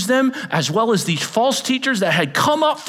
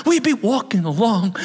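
usual speaking voice

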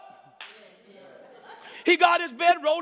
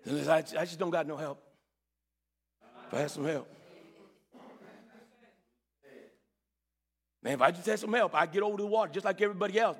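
lame man lamenting; 8 semitones lower, 5 dB quieter, faster than usual. Acted. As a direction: lower and softer, a weary cripple's lament.

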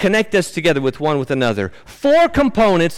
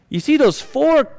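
ordinary talk, nothing marked

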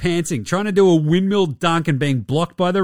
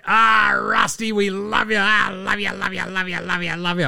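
mock adoring; 5 semitones higher than usual. Mock doting on Westbrook.

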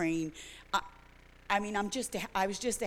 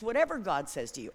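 ordinary talk, nothing marked

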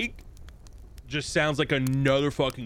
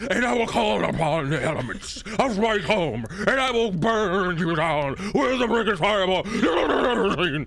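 loud, higher, fired-up preacher voice; enthusiastic pastor; 8 semitones higher, 5 dB louder than usual. Acted. Casting a spell like a pastor, over-the-top enthusiastic preaching. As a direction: preach it with mock fervour. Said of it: "like a, a really enthusiastic pastor".

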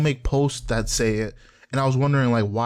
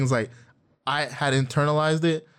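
normal talking voice

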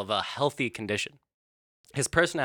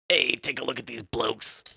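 put-on smoker's rasp, British; British tough guy with a smoker's voice; raspy British voice. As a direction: rasp it like a smoky British gangster. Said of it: "Cigarette smoke and a raspy voice".

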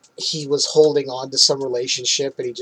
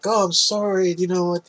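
pleading imitation; 5 semitones higher, 3 dB quieter than usual. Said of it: "Pleads with her to come back".

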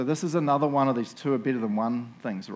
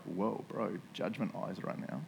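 imagined audience reaction; 5 semitones lower, 11 dB quieter, slower than usual. Retold or imitated. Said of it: "looking at me like".

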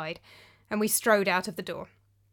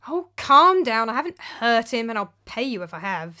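dismissive; 2 semitones higher, 6 dB louder than usual. Acted. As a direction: wave away the fuss. Philip dismissing the anger.

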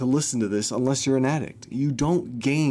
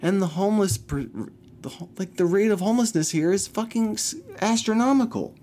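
rising outrage; 8 semitones higher than usual. Real, not acted. Ranting about homelessness.

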